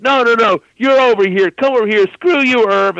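high, loud, pushy; mock insistent shout. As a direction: pull Barry over, shouting Irv down. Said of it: "Jay's like".

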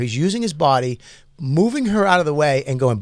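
normal talking voice

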